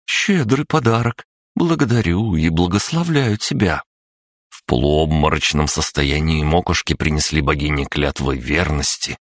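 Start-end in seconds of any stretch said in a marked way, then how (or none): 0.0-3.8 higher, solemn goddess blessing. Acted.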